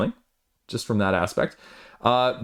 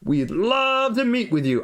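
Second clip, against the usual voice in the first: put-on old cowboy accent; 11 semitones higher than usual. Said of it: "I went with a sort of old cowboy accent there".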